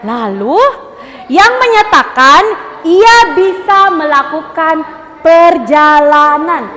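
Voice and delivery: very slow, drawn-out storybook reading; reading aloud demonstration. Shown as a demonstration. Teacher models reading for the class, slow drawn-out read-aloud. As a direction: stretch each phrase as if reading to children. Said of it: "akan baca seperti ini".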